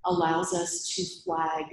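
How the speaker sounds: ordinary talk, nothing marked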